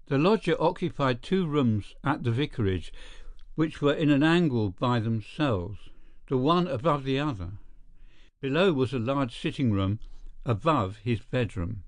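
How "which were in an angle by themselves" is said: usual speaking voice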